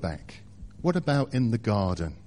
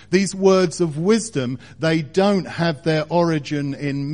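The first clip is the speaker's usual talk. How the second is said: louder, higher, driving pulpit voice; fervent preacher building to a peak; preaching at full force; 5 semitones higher, 6 dB louder than usual. Acted. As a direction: lift it and press every phrase home.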